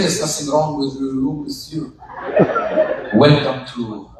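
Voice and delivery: low, soft deadpan concierge; unbothered hotel clerk. Retold or imitated. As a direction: dismiss the guest in a low, smooth murmur. Hotel clerk dismisses the complaint.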